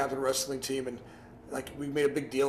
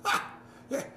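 relieved whisper; 10 semitones higher than usual. Retold or imitated. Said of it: "I'm like".

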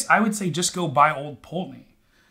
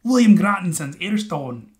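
put-on Scottish accent; 2 semitones higher, 3 dB louder, slower than usual. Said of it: "speak in a Scottish accent".

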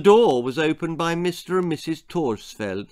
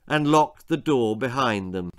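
ordinary delivery, nothing marked

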